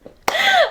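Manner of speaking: short high burst of laughing; burst of laughter. Real, not acted. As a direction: laugh it off as you finish. Wrapping up her vlog.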